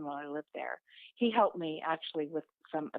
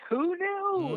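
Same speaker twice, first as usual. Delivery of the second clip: much higher, louder mock surprise; sarcastic who knew; 12 semitones higher, 5 dB louder than usual. Mocking the obvious about the narcissist, high sarcastic surprise. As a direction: act shocked, dripping with sarcasm.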